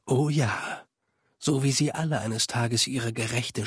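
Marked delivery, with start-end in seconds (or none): none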